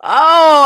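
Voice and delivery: high, loud cry of outrage; anguished cry. Real, not acted. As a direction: let out a wailing oh. Mock outrage over survival badges.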